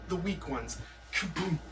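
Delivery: normal talking voice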